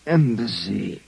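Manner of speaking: low, slow, dazed waking; groggy groan. Acted. Drugged detective waking up dazed, low groggy groan. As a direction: come to with a pounding head.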